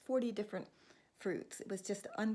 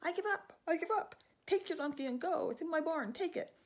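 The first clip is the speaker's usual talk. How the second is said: high, loud cry of surrender; 10 semitones higher, 6 dB louder than usual. Acted. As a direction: yell out that you give up under the beating. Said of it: "till finally he cries".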